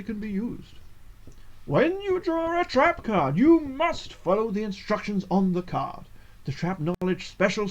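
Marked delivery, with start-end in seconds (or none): 1.7-6.0 put-on wise old man voice. Shown as a demonstration. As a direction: intone it like an ancient wizard. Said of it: "it's just my wise old man voice".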